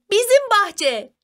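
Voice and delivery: high, drawn-out excited call; shouted in unison. A narrator's reading. Both children solve the last riddle, high excited shout. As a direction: call out the answer slowly on a high pitch. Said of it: "diye bağırdılar aynı anda".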